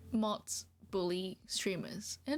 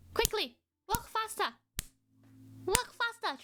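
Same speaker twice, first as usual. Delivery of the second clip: mock command; 11 semitones higher than usual. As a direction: hurry the lazy animal along. Driving troll faster.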